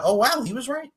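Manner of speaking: very high-pitched pretend wonder; mock amazement. Co-host mimicking the reaction to House's guess, mock-amazed.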